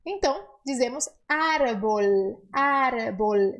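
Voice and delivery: árbol with a clear L at the end, never an U; clear Spanish L. Shown as a demonstration. Teacher models Spanish árbol for students: final L kept clear. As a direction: say árbol ending on a firm L. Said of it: "O L no espanhol é L sempre".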